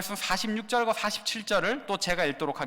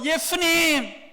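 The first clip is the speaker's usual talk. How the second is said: long, drawn-out higher call; shouted call; 6 semitones higher, 3 dB louder, much slower than usual. Acted. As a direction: call his name across the crowd. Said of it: "소리 지른 거예요".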